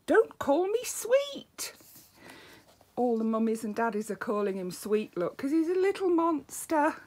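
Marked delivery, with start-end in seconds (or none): 0.1-1.7 much higher, slow and drawn out; high sing-song refrain. Acted. Little monster protesting to everyone, high and slow. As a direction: lift the refrain up high and stretch it.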